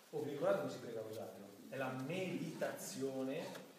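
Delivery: ordinary delivery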